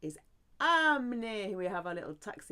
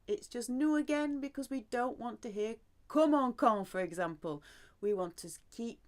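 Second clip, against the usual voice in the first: normal talking voice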